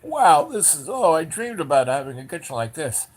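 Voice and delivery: high, loud wow easing into gushing; awestruck delight. Retold or imitated. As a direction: imitate someone thrilled by a dream find. Vegans marvel at the kitchen.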